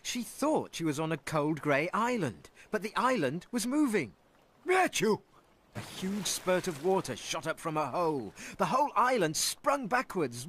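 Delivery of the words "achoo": big high sneeze; loud sneeze. Acted. Whale sneezes.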